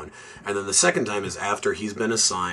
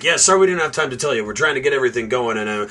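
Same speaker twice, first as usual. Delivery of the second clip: fast, higher, brash movie-cop impression; rushed cocky cop; 4 semitones higher, faster than usual. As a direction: rattle it off like a smug cop brushing him off. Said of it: "he's like".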